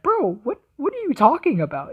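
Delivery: high, disbelieving what are you talking about; incredulous. Retold or imitated. Reactor answering haters with high, incredulous disbelief. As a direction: pitch jumps up in mock disbelief. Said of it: "It's like".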